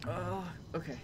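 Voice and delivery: breathy whisper in pain; hushed whisper while straining. Real, not acted. Putting on mascara.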